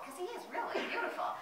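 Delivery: ordinary delivery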